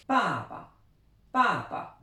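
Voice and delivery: "Papa" with a high, falling first syllable and a light neutral second; Chinese tone demo. Shown as a demonstration. Teacher models Chinese papa for learners, high falling tone then toneless syllable. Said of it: "on insiste bien sur la première syllabe".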